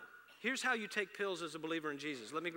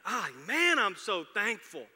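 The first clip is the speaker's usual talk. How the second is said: a louder, drawn-out happy dad; exuberant dad; 4 semitones higher, 11 dB louder, slower than usual. Retold or imitated. Dad walks in gushing to his teenage daughters, exuberant. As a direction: gush it like a proud dad. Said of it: "I would say".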